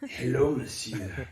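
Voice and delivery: low, slow mock French greeting; mock Frenchman greeting a table; French accent. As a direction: purr it like a Frenchman. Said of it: "Let's determine".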